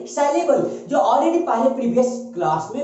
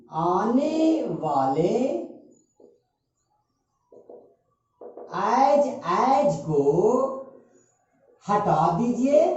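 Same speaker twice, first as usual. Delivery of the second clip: stretched syllables, long pauses between phrases; very slow, phrase-by-phrase dictation; much slower than usual. Real, not acted. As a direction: dictate for students writing it down. Teacher dictating the rule slowly.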